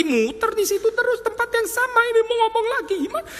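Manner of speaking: voicing Jesus, frustrated with the crowd; fed-up Jesus. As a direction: squeal out the frustration. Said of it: "Yesus juga rasa kayaknya ini useless".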